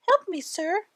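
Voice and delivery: high, pleading little man's cry. A narrator's reading. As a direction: call up for help as the tiny trapped man. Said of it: "cried the little man".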